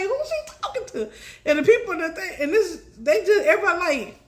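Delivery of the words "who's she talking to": bewildered crowd. Retold or imitated.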